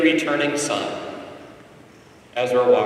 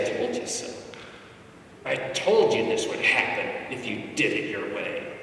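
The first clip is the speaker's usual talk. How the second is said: mock lecture; 4 semitones lower, 7 dB quieter than usual. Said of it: "you lecture him and say".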